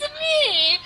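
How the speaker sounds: very high cartoon Italian cry; high, playful impression; Mario. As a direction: squeal it like a video game mascot. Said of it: "Like, Italy sounds like Mario".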